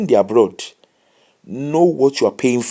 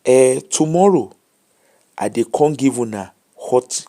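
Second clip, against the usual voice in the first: ordinary delivery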